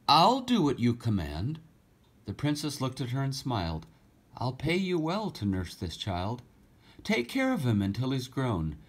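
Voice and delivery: lively storybook reading, rhyming lilt; story reading. A narrator's reading. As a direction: read the verse aloud to children with feeling. Rhyming story read aloud.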